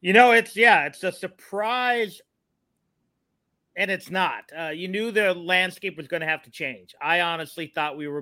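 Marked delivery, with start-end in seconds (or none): none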